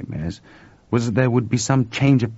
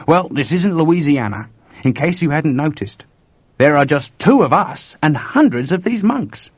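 uneasy Chuck; 7 semitones higher, 6 dB louder than usual. A narrator's reading.

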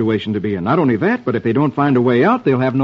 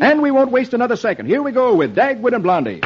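higher, eager send-off; eager announcer; 9 semitones higher than usual. Acted. Host whisking child into the comic, eager. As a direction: launch it with gusto.

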